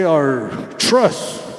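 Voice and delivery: usual speaking voice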